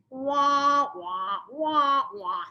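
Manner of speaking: high muted wah wah, fading out; Charlie Brown adult voice. Retold or imitated. Tuning out husband's yelling, Charlie Brown wah wah. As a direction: do the trombone teacher noise. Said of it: "sounded like the Charlie Brown voice".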